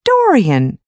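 high, distressed calling of a name; unhappy. A narrator's reading. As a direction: plead unhappily, the second call stronger. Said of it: "said Basil unhappily".

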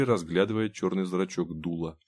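ordinary delivery

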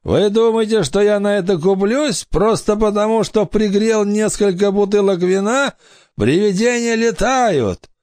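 high-pitched indignant pirate; scoffing pirate. A narrator's reading. Pirate sees through fake ghosts, high scoffing voice. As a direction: dismiss the trick in a high pirate voice. Pirate refuses to believe them.

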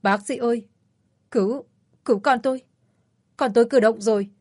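high, trembling disbelief. A narrator's reading. Mother calls doctors as her baby moves, trembling. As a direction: call the doctor, shaking with hope. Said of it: "giọng nói run rẩy".

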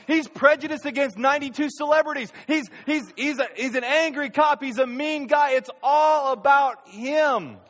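high, loud, fast protest blaming the cop; indignant blame. Retold or imitated. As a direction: whine loudly that it was his fault. Preacher voices a blaming celebrity.

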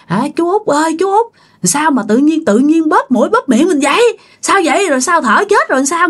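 high-pitched, mildly panicked questions; alarmed relatives. Acted. Relatives rushing to stop their uncle choking himself, alarmed.

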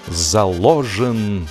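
usual speaking voice

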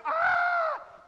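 high, loud drawn-out "Ah!"; panicked scream. Retold or imitated. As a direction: scream out like terrified disciples in a storm. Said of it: "They're screaming".

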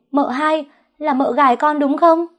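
high, loud outraged shriek; shrieking accusation. A narrator's reading. Maid screaming at the second wife, loud shriek. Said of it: "gào lên".

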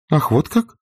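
high, startled "Ах, вот как?"; puzzled exclamation. A narrator's reading. Scarlett baffled by Rhett's reply, surprised exclamation. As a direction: caught off guard. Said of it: "воскликнула она, совершенно озадаченная".

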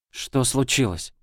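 worried. Acted. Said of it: "забеспокоилась девочка".

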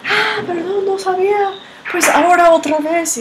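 very high-pitched acted-out apology; mock-innocent apology. Big sister excusing herself to little brother after his fall, high mock apology.

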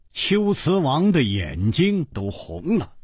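usual speaking voice

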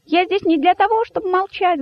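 very high, defiant little voice; high-pitched rabbit speaking up; outspoken rabbit. A narrator's reading. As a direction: declare it boldly in a high pipe. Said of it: "громко сказал кролик".

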